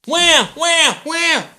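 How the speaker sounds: loud high shouted wham; shouted comic sound effect for the birth. Acted.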